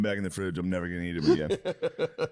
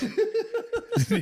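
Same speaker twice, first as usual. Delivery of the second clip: high laugh. Real, not acted. Laughing at own quote.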